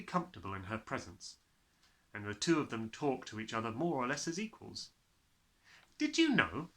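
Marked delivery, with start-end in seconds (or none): 6.0-6.7 put-on a girl bursting with a fact, higher; sudden eager question. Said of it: "Matilda said suddenly".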